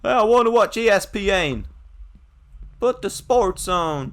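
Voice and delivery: loud, high, hammed-up holler; put-on bellow, way up in pitch; mock demanding shout. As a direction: yell for the telly, stretching the words. Shouting as bedridden patient demanding TV.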